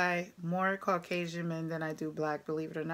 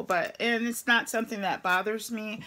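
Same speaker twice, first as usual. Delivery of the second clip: normal talking voice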